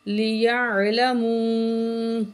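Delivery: slow, drawn-out tajweed chanting; Quran recitation. Shown as a demonstration. Teacher recites the verse for students, slow melodic chant. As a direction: recite slowly with full nasal hum.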